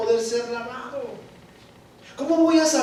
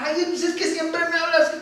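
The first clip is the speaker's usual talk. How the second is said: whiny mock complaint pitched higher; victim voice; 8 semitones higher than usual. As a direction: sound sorry for yourself. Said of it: "me pongo como víctima". (voz de víctima quejumbrosa)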